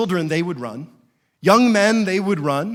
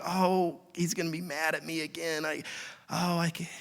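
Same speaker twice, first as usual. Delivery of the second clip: hushed, meek dread; mock cowering; 9 dB quieter than usual. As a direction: act out shrinking from a scolding, softly. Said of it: "we cower. It's like".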